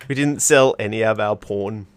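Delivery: ordinary delivery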